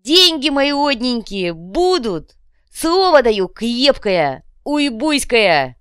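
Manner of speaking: loud, slow, high oratory; solemn public promise. A narrator's reading. Копыта promises the crowd their money, proclaiming grandly. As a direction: proclaim your oath word by word.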